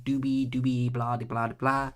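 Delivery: ordinary talk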